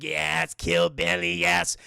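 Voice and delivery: slow, rasped villain relish; gloating gremlin voice. Retold or imitated. Streamer as Stripe finding a gun, gloating creature voice. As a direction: croak it slowly like a scheming monster.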